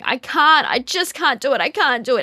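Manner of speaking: put-on high, loud, upset child's wail; whiny toddler. Toddler giving up on a hard task, high loud whine. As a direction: play a defeated toddler giving up. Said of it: "the image that comes to mind is toddlers going".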